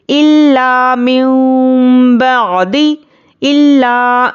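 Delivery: slow, melodic tajweed recitation; measured Quranic chant. Shown as a demonstration. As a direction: chant it slowly like a qari. Recites verse fragment.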